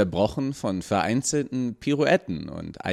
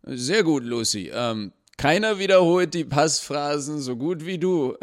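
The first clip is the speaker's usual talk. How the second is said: higher, loud announcement to the room; announcing aloud; 8 semitones higher, 4 dB louder than usual. A narrator's reading. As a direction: proclaim for everyone, covering up. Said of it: "verkündete er zu niemand Bestimmten". (laut verkündend, höher)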